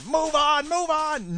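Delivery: mock annoyed listeners hurrying him; impatient listeners. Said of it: "banging on your iPods in frustration".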